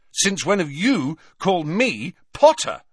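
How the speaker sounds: high-pitched comeback ending on "Potter"; indignant retort. A narrator's reading. Harry answering Hagrid's challenge, indignant retort. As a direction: throw "Potter" back at him. Harry throws the question back.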